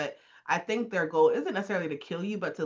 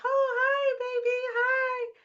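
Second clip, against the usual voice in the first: high, slow cooing; baby talk to pet; slower than usual. Retold or imitated. Couple greeting their cat, high slow baby talk. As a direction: coo at the cat sweetly.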